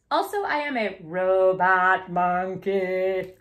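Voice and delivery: slow, stiff robot monkey voice. Acted. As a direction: drone it out like a machine. Reading silly line as robot.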